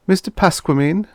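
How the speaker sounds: high, bright sing-song call of the name; chimed greeting. A narrator's reading. Nelson chimes a cheery hello to Pasquamine down the phone. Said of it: "I chimed".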